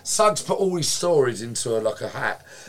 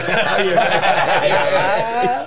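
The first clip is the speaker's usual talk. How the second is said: laugh; 7 semitones higher, 7 dB louder than usual. Real, not acted. Laughing at his own story.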